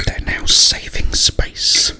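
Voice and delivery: ordinary delivery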